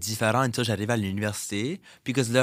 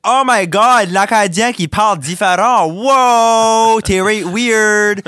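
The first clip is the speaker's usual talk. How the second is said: shrieking, much higher and louder caricature of gawking Quebecers; mock amazed Québécois; 12 semitones higher, 15 dB louder, much slower than usual. Imitating Quebecers gawking at the Acadian's accent, mock shriek. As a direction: ham up their shocked reaction to the Acadian. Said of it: "c'est comme, like".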